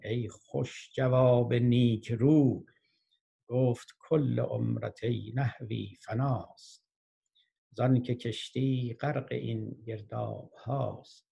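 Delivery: very slow, stretched verse delivery; poetry recitation. Acted. Boatman warning the grammarian in verse, slow solemn recitation. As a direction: draw out each phrase, pausing between half-lines.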